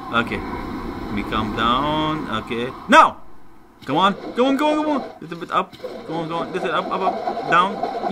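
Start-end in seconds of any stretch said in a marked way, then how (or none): 2.9-8.1 high, tense urging; will the shot home; excited high-pitched coaxing after a shouted cue; urgent egging on. Real, not acted.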